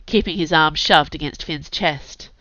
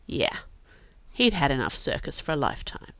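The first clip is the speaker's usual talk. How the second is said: plain reading voice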